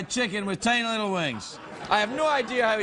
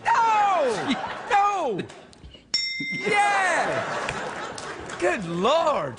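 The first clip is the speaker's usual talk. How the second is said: shouting; 8 semitones higher, much slower than usual. Real, not acted. Sudden loud outbursts.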